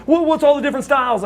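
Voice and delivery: high, fast mocking question; cocky challenge. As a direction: toss the question off like a dare. Rude challenging him, retold.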